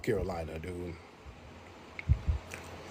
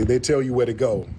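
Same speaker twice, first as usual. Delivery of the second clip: normal talking voice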